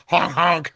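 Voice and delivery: playful spoken honking noise; imitated horn honk. Man feeling up the girl, playful honking.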